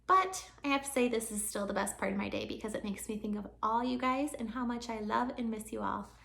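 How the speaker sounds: ordinary delivery, nothing marked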